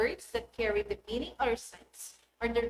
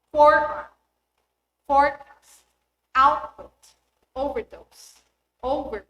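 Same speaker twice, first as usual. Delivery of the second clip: FOREarm, FOREcast, OUTput, Overdose, Overpass with the prefix punched; first-syllable stress; 2 semitones higher, 8 dB louder than usual. Shown as a demonstration. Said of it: "first syllable tends to be strongly stressed".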